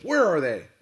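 loud, high demand; furious. A narrator's reading. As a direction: roar the question out. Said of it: "In his fury".

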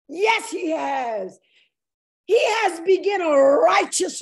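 forceful preacher's proclamation; impassioned preaching. Real, not acted. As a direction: declare it with fervor, stretching the key words.

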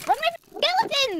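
high panicked yell; urgent shout. Real, not acted. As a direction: shout it to save her. Urging mom to flee.

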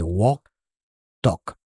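"walk" and "talk" with no L sound; silent L. Shown as a demonstration. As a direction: say "walk", "talk" as wok, tok. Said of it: "La L no se pronuncia".

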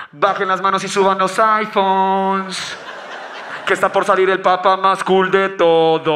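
slow, drawn-out arena announcer calling the crowd; hype announcer. Pretend. Announcer hyping the crowd for the Pope's entrance, slow and booming. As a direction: stretch every word like a stadium MC.

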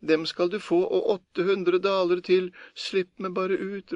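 high, desperate begging; priest pleading. A narrator's reading. Priest in chest bargains with Per, desperate pleading. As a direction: plead frantically to be let out. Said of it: "ropte presten".

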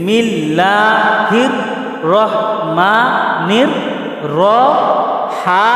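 slow drawn-out chanted recitation; Quranic recitation. Shown as a demonstration. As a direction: stretch every syllable like Quran reading. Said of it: "এখন কি আমি single পড়বো".

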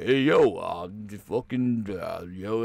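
slow, mumbled mock Rocky; Rocky impression. Rocky mumbling a reply, lightly mocking impression. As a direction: slur it like punch-drunk Rocky. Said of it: "Rocky was like".

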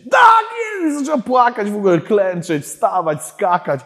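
very high, drawn-out shout; tenfold ecstatic outburst. Retold or imitated. Woman hearing the name Debora, imitated as an ecstatic shriek. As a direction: shriek it in imitation of her overjoyed reaction. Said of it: "ona wtedy razy dziesięć".

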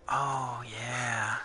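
normal talking voice

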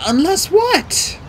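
high, drawn-out question; anxious prompting. A narrator's reading. Girl presses her mentor to finish, anxious. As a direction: press eagerly for the rest.

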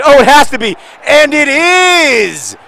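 very high, shouted goal commentary; goal call. Real, not acted. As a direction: scream the goal, stretching the last word. Calls his goal as commentator.

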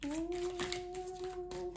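high delighted ooh; excited ooh. Real, not acted. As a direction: squeal of delight at the cards. Excited over tarot reading.

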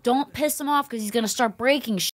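warning mom. Retold or imitated. Mom warning the kids not to anger the ghost, hammed-up scolding. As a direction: scold them like a fed-up mom. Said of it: "she's like".

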